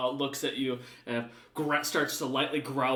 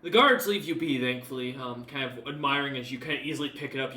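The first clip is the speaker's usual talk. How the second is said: ordinary delivery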